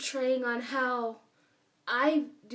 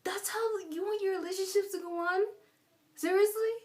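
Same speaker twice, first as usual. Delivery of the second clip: much higher, squeaky disbelief; mock whiny cry of disbelief; incredulous outburst; 8 semitones higher than usual. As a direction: replay your own stunned reaction, pitched way up. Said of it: "I'm like".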